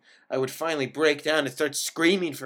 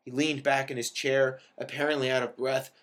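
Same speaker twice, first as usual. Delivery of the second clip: plain reading voice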